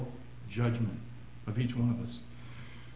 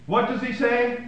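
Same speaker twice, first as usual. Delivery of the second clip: normal talking voice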